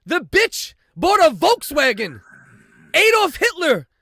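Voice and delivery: loud, high-pitched incredulous rant; exasperated outburst. Real, not acted. Host rants about the Volkswagen purchase, loud exasperated outburst.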